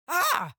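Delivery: sudden high loud yelp of pain; pained shout. Acted. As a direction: yelp as the cat bites the finger. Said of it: "Kieran shouted".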